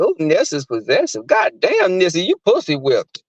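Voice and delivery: normal talking voice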